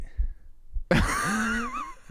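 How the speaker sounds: squealing high laugh; high-pitched laugh. Real, not acted. Laughs at joke.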